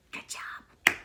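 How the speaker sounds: breathy whispered "Good job"; whispered sarcasm. Real, not acted. Mom muttering to herself about the teacher, whispered. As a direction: whisper it as a bitter aside.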